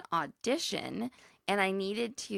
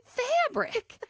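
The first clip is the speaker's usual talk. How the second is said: slow, higher mock Oklahoma drawl; southern accent; 8 semitones higher, much slower than usual. Retelling Linda's fabric claim, slow mock southern drawl. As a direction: stretch it out like Linda. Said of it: "She goes".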